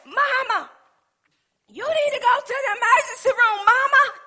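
high, urgent mock panic; imitating alarmed son. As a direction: call out like a panicked child to his mother. Son alarmed at her swollen hand.

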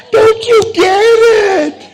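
loud, high cry; shouted plea. Real, not acted. Pastor crying out to the congregation, loud and high. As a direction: cry out to make them see. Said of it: "crying out to congregation".

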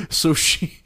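normal talking voice

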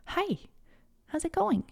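put-on high, soft, gentle hello to a little child; soft high sing-song greeting; sweet voice for a toddler.

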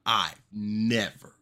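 "never" dragged out, slow and loud; stress on never. Acted. Host stresses never before a big claim, slow and loud. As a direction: lean hard on "I've never". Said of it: "And when I say never".

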